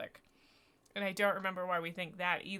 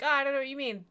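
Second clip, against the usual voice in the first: evasive defensive; 5 semitones higher, 7 dB louder, much faster than usual. Retold or imitated. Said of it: "that was him defending his date life".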